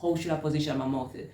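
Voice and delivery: ordinary talk, nothing marked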